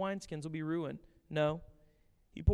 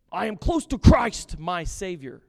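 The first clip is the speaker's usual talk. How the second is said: pompous mock piety; 2 semitones higher, 10 dB louder than usual. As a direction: show off your holiness. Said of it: "mimicking hollow believers".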